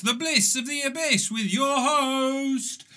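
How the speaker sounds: game show host. Pretend. Mock TV show opening.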